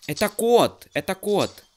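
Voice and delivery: loud high cry of surprise; startled shout. Real, not acted. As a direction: yell it out, suddenly shocked. Sudden scare in horror game.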